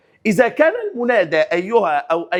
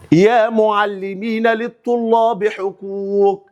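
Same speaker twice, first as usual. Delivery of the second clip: reads the sentence out slowly, word by word; slow reading; 3 semitones higher, much slower than usual. Shown as a demonstration. Teacher slowly reads the exercise sentence to students. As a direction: spell out each word for the class.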